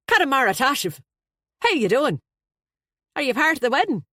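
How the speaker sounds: loud, high, hearty Irish voice; a flustered, jovial Dubliner blurting out; Irish best man. Acted.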